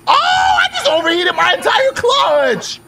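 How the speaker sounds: high, loud, slow dismayed yell; anguished shout at the broken car. Real, not acted. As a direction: cry out in dismay. Clutch breaks in game.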